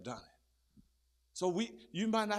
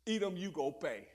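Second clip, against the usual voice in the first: ordinary delivery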